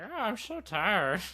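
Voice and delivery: weary mock groan; lightly acted exhausted moan; tired Saul. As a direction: complain wearily, half laughing. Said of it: "you can hear Saul being like".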